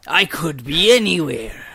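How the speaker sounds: playful West Country English burr; Southwestern English accent. Pretend. Friend adding to the accent joke, in Southwestern English. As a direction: ham up the Southwestern English lilt. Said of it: "Southwestern English".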